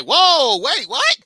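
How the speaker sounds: high, loud double take; shocked surprise. Retold or imitated. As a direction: yelp it in disbelief. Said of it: "I was like".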